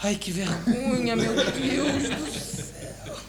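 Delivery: slow, high wail of embarrassment; hammed-up embarrassed moan; mortified. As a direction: drag it out, trailing away in shame. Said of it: "quando se fala nisso pra ele".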